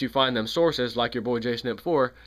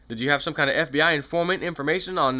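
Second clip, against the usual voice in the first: normal talking voice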